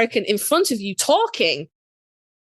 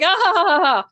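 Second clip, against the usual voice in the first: higher-pitched mock "ah ha ha"; imitated laugh; 7 semitones higher, 3 dB louder than usual. Fan retelling Jared's reaction, imitated laugh. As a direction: laugh it out as spoken syllables.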